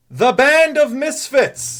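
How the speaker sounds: high, slow, drawn-out herald call; announcer shout. Acted. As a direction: proclaim the winning party name. Priest announcing the drawn group.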